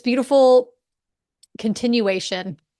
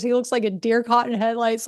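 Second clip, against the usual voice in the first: normal talking voice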